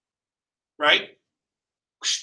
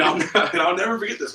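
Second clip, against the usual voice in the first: ordinary delivery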